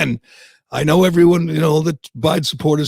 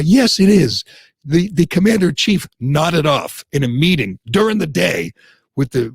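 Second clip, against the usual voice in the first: ordinary talk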